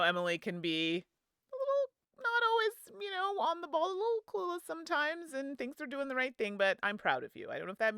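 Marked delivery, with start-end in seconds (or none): 1.5-6.6 higher, light wry jab; teasing aside. Acted. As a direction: poke fun gently. Gentle dig at Emily.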